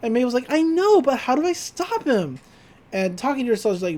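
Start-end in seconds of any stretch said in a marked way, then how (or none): 0.5-2.4 voicing Mabel. Mabel voiced in retelling.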